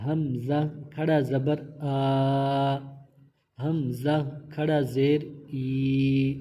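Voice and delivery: each letter and vowel drawn out slowly and clearly; تلفظ کی مشق، آہستہ اور واضح; slow spelling drill. Shown as a demonstration. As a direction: spell it out for a beginner, stretching قوف.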